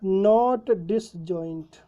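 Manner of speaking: drawn out very slowly while writing; slow dictation. Shown as a demonstration. Teacher writing the answer on the board, very slow. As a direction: dictate it word by word. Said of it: "इसका मतलब ये क्या हो गया?".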